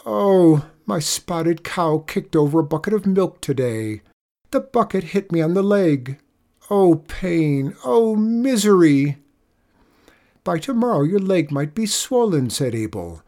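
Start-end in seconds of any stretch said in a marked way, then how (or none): 0.1-9.2 milk the pain like a hammed-up sufferer; groaning. A narrator's reading. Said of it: "Nagel groaned". 10.4-12.5 higher-pitched worried farmer; alarmed warning. A narrator's reading. Abel warns Nagel of worse.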